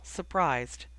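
ordinary delivery, nothing marked

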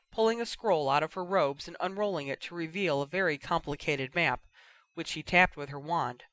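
plain reading voice

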